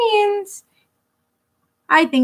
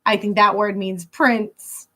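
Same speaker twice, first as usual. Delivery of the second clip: plain reading voice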